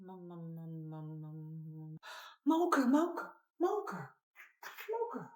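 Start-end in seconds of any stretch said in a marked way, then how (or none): 2.5-5.3 put-on much higher, excited toddler-style chant; excited squeal. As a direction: squeal the word with delight. Excited repeat of toddler word.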